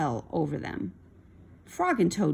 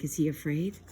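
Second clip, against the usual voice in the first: plain reading voice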